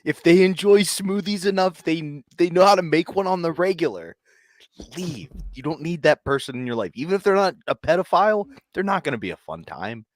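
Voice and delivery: heated rant. Real, not acted. Loud mock advice rant.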